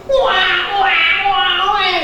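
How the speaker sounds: put-on high wah wah baby crying; imitated baby wail. Imitating the baby wailing while the tube goes in. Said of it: "nangis kejar".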